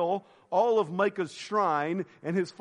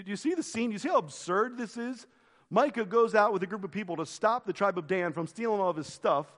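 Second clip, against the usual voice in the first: ordinary talk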